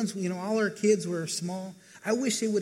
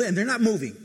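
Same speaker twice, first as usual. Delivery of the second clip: ordinary talk, nothing marked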